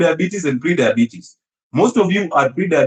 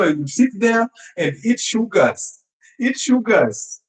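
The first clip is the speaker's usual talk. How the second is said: higher, slow, dreamy mock misery; mock heartbroken eater; 7 semitones higher, slower than usual. Doctor playing a heartbroken patient, mock misery. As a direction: drag it out like a sulking sugar addict. Acting out heartbroken sugar eating.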